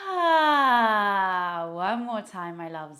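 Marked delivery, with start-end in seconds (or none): 0.1-1.7 long loud "ah" sigh; audible letting-go sigh. Shown as a demonstration. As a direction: let it all go in one big falling exhale. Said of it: "Let it go".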